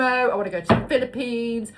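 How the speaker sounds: loud excited travel list; excited wishlist. Real, not acted. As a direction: rattle off dream trips eagerly. Listing dream travel spots.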